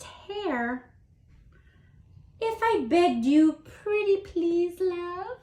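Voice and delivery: high, sweet turtle flattering his darling; wooing turtle. Acted. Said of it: "Said the turtle to his darling".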